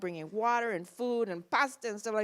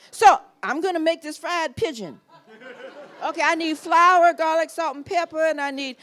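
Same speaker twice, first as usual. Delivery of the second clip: higher, louder recipe listing; eager instructions; 5 semitones higher, 6 dB louder than usual. Retold or imitated. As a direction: rattle off the recipe. Explaining recipe, loud.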